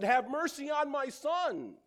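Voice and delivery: high, loud, slow plea; loud desperate father's plea; pleading cry. Acted. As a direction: beg him for your son.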